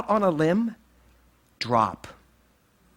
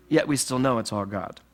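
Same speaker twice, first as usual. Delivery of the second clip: ordinary talk, nothing marked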